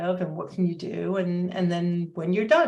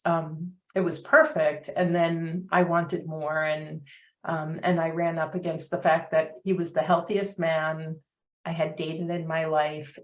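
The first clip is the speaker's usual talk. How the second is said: ordinary talk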